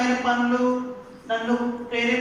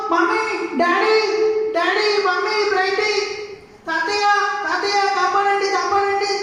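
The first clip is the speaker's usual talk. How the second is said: much higher, louder panicked cries; screaming for help; 9 semitones higher, 4 dB louder than usual. Acted. Stung boy screaming to his family for help. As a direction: scream for mum, dad and grandpa to save you.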